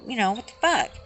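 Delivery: ordinary delivery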